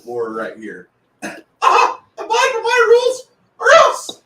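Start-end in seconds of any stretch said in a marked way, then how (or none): 1.7-4.2 high, loud, cartoon tyrant threat; mock menacing squeal with a laugh; hammy villain. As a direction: shriek the rules like a comic overlord. Reads villain meme in put-on voice.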